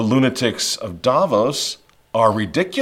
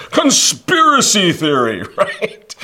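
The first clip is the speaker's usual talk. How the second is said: drawn-out mocking laugh through the words, higher; 7 semitones higher, slower than usual. Real, not acted. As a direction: scoff at the phrase while laughing. Mocking the label.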